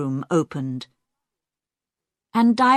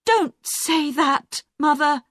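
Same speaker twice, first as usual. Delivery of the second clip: pleading cry; 8 semitones higher than usual. Acted. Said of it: "Jackie cried".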